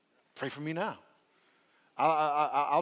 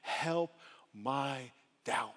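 slow pleading; much slower than usual. Shown as a demonstration. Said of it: "most powerful statements".

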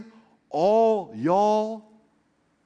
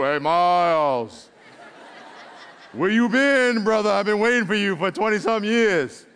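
lightly voiced big movie-star God greeting Miles; James Earl Jones; 2 semitones higher, 3 dB louder than usual. Preacher imagining God sounding like James Earl Jones, lightly voiced. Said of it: "like James Earl Jones".

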